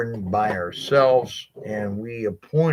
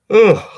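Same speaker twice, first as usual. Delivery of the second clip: hiccup groan; 12 semitones higher, 14 dB louder than usual. Real, not acted. Sudden hiccup, then he apologizes.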